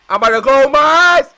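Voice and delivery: high, loud frightened yell; scared shout. Real, not acted. Frightened outburst.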